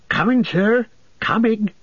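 high, quick call back; a hurrying hobbit lad answering; eager young servant. A narrator's reading.

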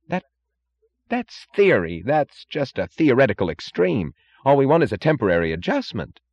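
high-pitched, faltering then insistent; flustered official. A narrator's reading.

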